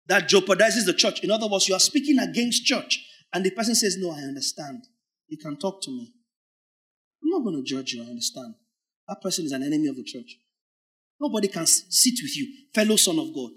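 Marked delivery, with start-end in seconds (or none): 4.0-8.5 mock soothing listener. Sympathetic listener voiced softly.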